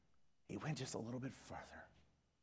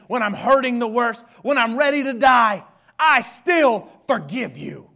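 fervent pulpit shout; full-force preaching; 12 semitones higher, 20 dB louder than usual. Acted. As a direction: preach it at the top of your voice.

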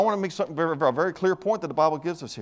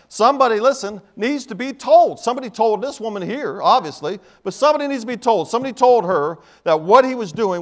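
higher, louder pulpit delivery; impassioned preaching; 4 semitones higher, 5 dB louder than usual. Real, not acted. Preacher urging church to share the gospel, impassioned. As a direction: preach it hard, punching the key words.

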